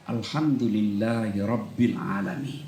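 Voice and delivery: soft, low, sincere rising praise; heartfelt gratitude. Shown as a demonstration. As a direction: let it land in the heart. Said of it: "supaya dia berkesan di hati".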